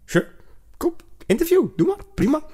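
high, breezy imitation of a relaxed actor; easygoing guy. Mimicking the actor who agreed to an interview, breezy high mock voice. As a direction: toss it off like a carefree celebrity. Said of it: "hij zit van".

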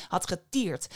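usual speaking voice

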